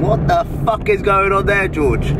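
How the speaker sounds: baffled onlooker. Retold or imitated. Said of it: "People might think".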